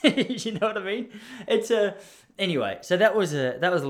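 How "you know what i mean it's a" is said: higher, laughing question. Real, not acted. Asking listeners to agree, laughing. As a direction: laugh as you ask.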